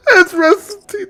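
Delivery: high, loud laugh-crying exasperation; half laughing, half despairing at the writer. Real, not acted. Mocking the show's writer, laughing in high despair.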